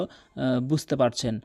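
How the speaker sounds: usual speaking voice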